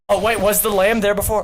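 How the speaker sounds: normal talking voice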